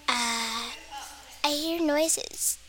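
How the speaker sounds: very soft and slow, frightened; soft, slow frightened murmur; scared whisper. Pretend. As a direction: whisper it slowly like a scared kid in the dark. Scared kid in bed at night.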